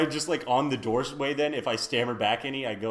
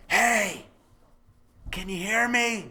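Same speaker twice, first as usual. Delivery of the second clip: high, urgent stage-whisper call; whisper shout; 8 semitones higher, 4 dB louder, slower than usual. Acted. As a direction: call out while trying to keep it hushed. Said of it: "I try to whisper shout".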